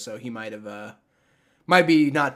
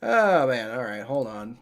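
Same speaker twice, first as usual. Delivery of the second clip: ordinary delivery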